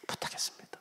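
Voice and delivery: barely audible acted whisper; whispered refusal. Teacher backing out of church service, whispered excuse. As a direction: back out under your breath.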